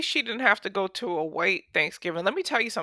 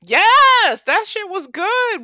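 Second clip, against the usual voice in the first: excited; 10 semitones higher, 8 dB louder, much slower than usual. Real, not acted. Raving about the turkey.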